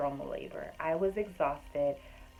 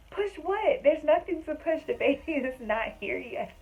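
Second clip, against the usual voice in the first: much higher, louder comic disbelief, laughing at the end; exasperated; 11 semitones higher, 6 dB louder than usual. Retold or imitated. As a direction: throw up your hands in mock despair. Her exasperated answer to mom.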